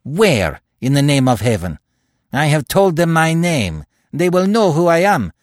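rising rage. A narrator's reading. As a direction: let the fury build until he's yelling. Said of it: "demanded Terry in sudden rage".

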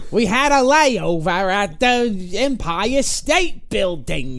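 terrible comic stage voice, dragged out slowly; Gilbert Gottfried. Retold or imitated. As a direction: stretch each word in an awful put-on voice. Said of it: "What a terrible voice".

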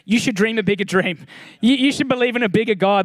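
ordinary talk, nothing marked

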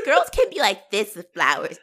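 usual speaking voice

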